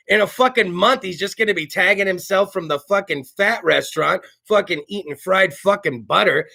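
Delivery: usual speaking voice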